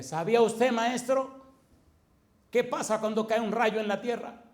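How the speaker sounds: loud heated preaching at a teacher; retold angry rebuke. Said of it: "estaba tan rabioso". (reprensión airada y fuerte)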